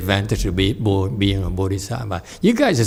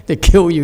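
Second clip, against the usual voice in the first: "They kill you" repeated much higher and louder; emphatic repeat; 7 semitones higher, 6 dB louder, faster than usual. Real, not acted. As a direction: hammer the punchline home. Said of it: "repeated punchline, louder".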